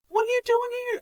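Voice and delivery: very high, delighted surprise; surprised greeting. Retold or imitated. Hospital worker to unexpected visitor, surprised greeting. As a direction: pitch it way up in happy surprise.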